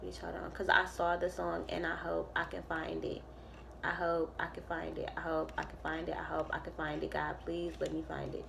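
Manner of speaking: mutter. Real, not acted. Searching for song, muttering.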